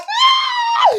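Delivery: very high, loud shriek; re-enacted scream of horror; shocked scream. Dog owner's daughter seeing pee on her shoes, shocked scream.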